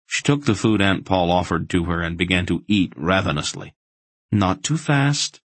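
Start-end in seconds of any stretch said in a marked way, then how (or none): 4.3-5.4 high, drawn-out caution; warning. A narrator's reading. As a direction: warn them, slowly and higher. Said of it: "Aunt Paul warned".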